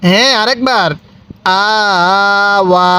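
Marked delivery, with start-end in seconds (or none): 1.6-2.9 long steady "আ", then long "ওয়া"; stretched vowels. Shown as a demonstration. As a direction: draw out both "আ" and "ওয়া". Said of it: "এরকমভাবে শিখব".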